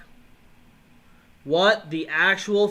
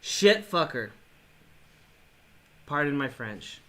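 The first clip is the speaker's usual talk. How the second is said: ordinary talk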